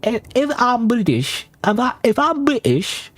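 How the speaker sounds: high, slow, hammed-up British accent saying stipend; bad British accent. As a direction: put on a terrible fake British accent. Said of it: "that's a horrible accent".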